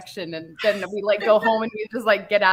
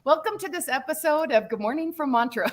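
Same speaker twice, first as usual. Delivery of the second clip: higher, hammed-up host opener; mock show intro; 8 semitones higher than usual. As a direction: announce it like a cheesy show host. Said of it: "mock show intro".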